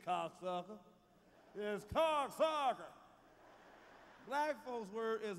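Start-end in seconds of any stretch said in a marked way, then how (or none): 0.1-0.8 low cocksucker, rising at the end. Shown as a demonstration. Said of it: "Our voice too heavy". 1.6-2.9 slow, drawn-out cocksucker. Shown as a demonstration. Showing how it should sound, slow and drawn-out. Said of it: "can't say cocksuckers".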